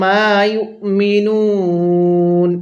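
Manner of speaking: মা ইউমিনুন slow and drawn out; slow tajweed recital; slow Quran recitation. Shown as a demonstration. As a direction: chant মা ইউমিনুন slowly, stretching the vowels. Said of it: "এরকমভাবে শিখব।".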